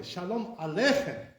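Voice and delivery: Aleichem as a-LEI-chem; wrong stress on LEI. Shown as a demonstration. Teacher showing wrong way, Aleichem stressed mid-word. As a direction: punch the middle syllable of Aleichem. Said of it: "you can say it the wrong way".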